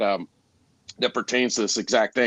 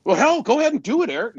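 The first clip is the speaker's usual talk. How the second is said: customer giving in; 12 semitones higher, 3 dB louder than usual. Retold or imitated. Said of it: "And he goes".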